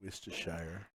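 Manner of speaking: Worcestershire said as "wiss-ter-shy-er", each syllable clear; careful pronunciation. Shown as a demonstration. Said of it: "it's so hard to pronounce".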